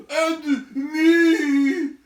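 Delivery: high, loud breathless cry with a gasp; high breathless panting cry. Acted. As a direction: puff it out as if running late. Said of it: "gasped Bombur".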